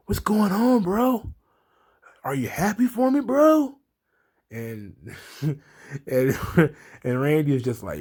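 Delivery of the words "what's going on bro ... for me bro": higher, airy surfer-bro whine; mock Riddle bro voice. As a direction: ham up a clueless, eager bro. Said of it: "And he's like".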